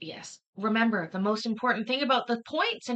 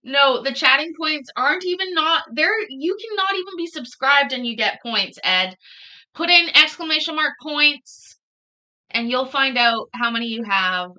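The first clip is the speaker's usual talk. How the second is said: louder, pushing the point; insistent; 4 semitones higher, 8 dB louder than usual. Real, not acted. Streamer, correcting a chatter about points, louder insistence. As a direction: set Ed straight, louder.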